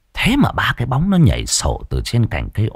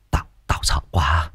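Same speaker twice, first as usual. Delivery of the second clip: hushed, scared whisper; frightened whisper; 5 semitones lower, 5 dB quieter than usual. Acted. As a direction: whisper it like a frightened old man. Scared confession to wife.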